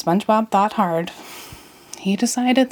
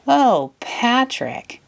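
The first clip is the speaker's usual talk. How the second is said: fond sigh; 6 semitones higher, much slower than usual. Acted. Fond correction.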